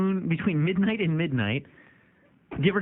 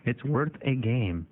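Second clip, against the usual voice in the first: slow echo; 4 semitones lower, much slower than usual. Shown as a demonstration. Host echoes slowly.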